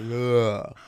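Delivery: drawn-out slack "ugh"; vowel falling out. Shown as a demonstration. Guest imitating vowels falling out of the mouth, slack. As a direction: let an open-mouthed "ugh" spill out. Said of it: "vowels just, like, fall out".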